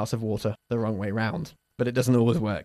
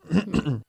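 a quick demonstrative throat clear; 5 semitones higher than usual. Shown as a demonstration. Teacher demonstrates clearing throat before an example. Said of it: "Now I'm going to clear my throat".

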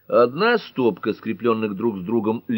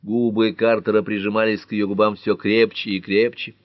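plain reading voice

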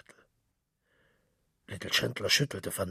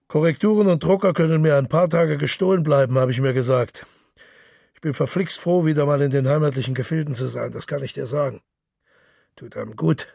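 hearty boastful Gallagher; 6 dB louder, faster than usual. Acted. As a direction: brag cheerfully across the bar table. Gallagher boasts about his holiday.

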